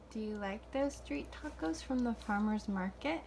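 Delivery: normal talking voice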